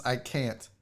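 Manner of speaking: normal talking voice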